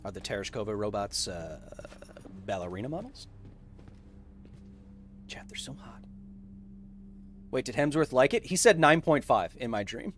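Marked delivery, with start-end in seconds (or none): 5.3-6.0 whispered aside to chat. Real, not acted. Streamer whispering to chat about the robots, whispered. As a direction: breathe it secretly to chat. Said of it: "aside to chat".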